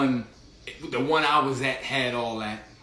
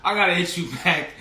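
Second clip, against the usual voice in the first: laughing through the words; can't hold back the laugh; 6 semitones higher, 7 dB louder, faster than usual. Retold or imitated. Laughing while retelling phone call.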